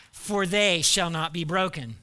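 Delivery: ordinary talk, nothing marked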